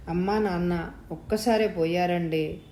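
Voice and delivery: ordinary delivery, nothing marked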